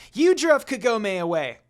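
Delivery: high, loud accusation; accusing shout. A narrator's reading. Shippo accusing Inuyasha, high and loud. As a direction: yell the blame at him.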